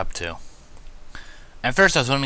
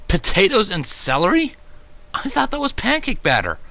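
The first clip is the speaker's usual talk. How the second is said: aghast surprise; 12 semitones higher, 6 dB louder than usual. A narrator's reading. Narrator learns what he ate.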